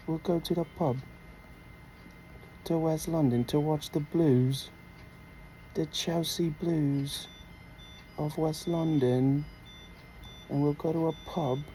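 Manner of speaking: excited mock British football fan; British accent. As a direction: spin a pub tale in a British accent. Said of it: "You like my British accent".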